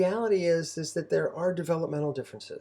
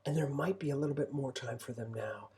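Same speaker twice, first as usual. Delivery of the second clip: ordinary talk, nothing marked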